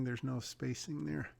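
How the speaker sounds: ordinary delivery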